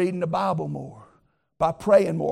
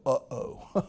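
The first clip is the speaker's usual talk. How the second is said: dismayed uh-oh; 4 semitones lower than usual. Pretend. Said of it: "They go".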